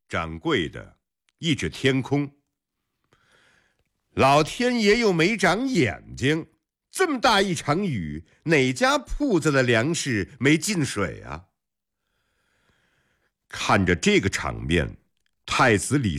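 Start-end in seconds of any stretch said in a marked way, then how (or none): 4.1-11.5 high-pitched indignant protest; brazen excuse. A narrator's reading. Shopkeeper blaming heaven for wet grain, indignant. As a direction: shrug off blame, pitched high.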